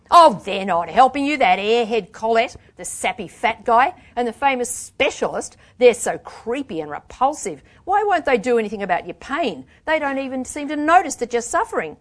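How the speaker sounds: angry tirade. A narrator's reading. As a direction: tear into the quacks with contempt. Angry tirade about the clinic.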